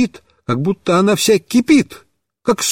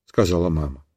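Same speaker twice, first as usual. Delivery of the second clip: usual speaking voice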